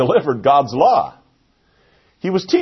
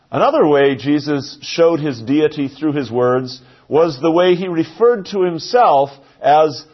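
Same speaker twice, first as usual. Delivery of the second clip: ordinary talk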